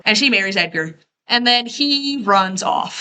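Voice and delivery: ordinary delivery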